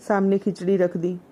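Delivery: ordinary delivery, nothing marked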